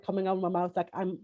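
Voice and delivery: normal talking voice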